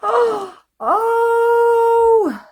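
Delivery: high, loud gasping "oh"; delighted gasping cry. Real, not acted. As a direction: gasp in awe at the necklace. Gasping at a necklace.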